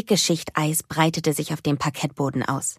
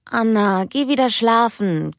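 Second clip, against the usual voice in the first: grumbling sleepily; 6 semitones higher, slower than usual. Acted. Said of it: "grummelte sie müde".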